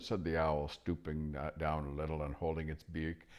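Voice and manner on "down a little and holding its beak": ordinary delivery, nothing marked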